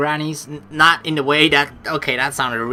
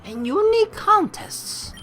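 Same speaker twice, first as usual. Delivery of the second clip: put-on higher, lilting French-accented teacher; 9 semitones higher than usual. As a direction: roll through it like a French gym leader. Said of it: "that was really butchered French".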